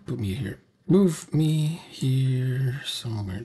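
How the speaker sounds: ordinary delivery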